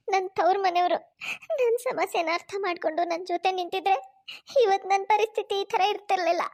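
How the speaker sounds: speaking through tears; tearful, broken lament; crying. Acted. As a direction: weep as she laments her family. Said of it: "Nandini ಹೀಗೆ ಅಳ್ತಾ ಕೂತಿರ್ತಾಳೆ".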